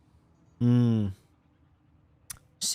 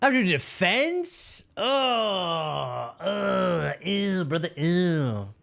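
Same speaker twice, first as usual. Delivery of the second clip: disgusted; 5 semitones higher, much slower than usual. Real, not acted. Reacting to a bad game reward.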